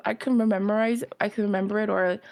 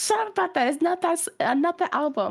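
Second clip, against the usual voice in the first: high, snapping mock irritation; mock annoyed sister; 7 semitones higher, faster than usual. Imitating her annoyed sister shutting her down, high and snappy.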